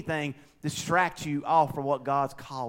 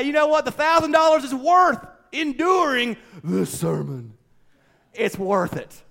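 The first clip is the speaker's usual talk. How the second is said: mock excited reasoning; 9 semitones higher, 8 dB louder than usual. Congregant justifying sitting through sermon.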